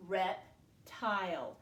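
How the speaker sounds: "Reptile" slowly, with a gap after "Rep"; syllable by syllable. Shown as a demonstration. Teacher works the word out with pupils, "Reptile" slowly split. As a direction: say "Reptile" as two separate words, rep... tile. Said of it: "break it down into two syllables".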